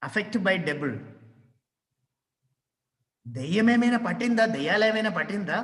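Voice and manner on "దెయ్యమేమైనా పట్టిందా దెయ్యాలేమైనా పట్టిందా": rapid Telugu demo. Shown as a demonstration. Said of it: "we ask".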